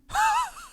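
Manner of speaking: a short very high laugh; high laugh burst. Real, not acted. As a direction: let out a quick squeaky laugh. Laughing at fork story.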